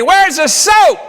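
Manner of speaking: high, loud imitation of a hollering wife. Wife in the shower to husband, mock hollering. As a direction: holler it out like the wife from another room. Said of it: "She's hollering at me".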